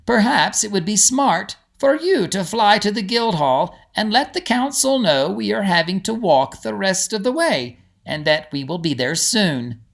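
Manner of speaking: steady the group with calm assurance; a lightly voiced reassuring guide; kindly guide Igri, calm. A narrator's reading. Igri's voice in the story.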